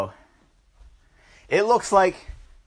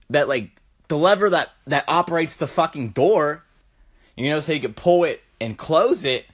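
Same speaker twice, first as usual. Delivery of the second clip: ordinary delivery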